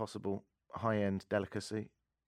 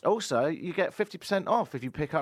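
bright high ad voice; hammy radio ad pitch; ad read; 8 semitones higher than usual. Acted.

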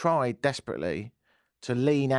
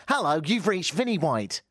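higher, recited voicemail greeting; reanimated answerphone greeting; 8 semitones higher than usual. Retold or imitated. Faking an answer phone for the helpline, animated recorded-greeting voice. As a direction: pretend to be your own recorded message. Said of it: "I even reanimated my voice".

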